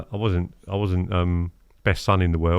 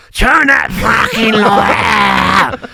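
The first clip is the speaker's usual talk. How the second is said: high, slow furious bellow across the water; angry yell; 12 semitones higher, 8 dB louder, much slower than usual. Retold or imitated. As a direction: bark it like a fed-up angler shouting over. Angry neighbour yelling across lake.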